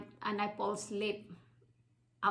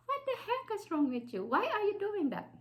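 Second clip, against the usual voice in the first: high-pitched mock scolding teacher; 11 semitones higher than usual. Teacher yelling at the girl with the knife, high and shrill. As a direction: play the shocked, angry teacher.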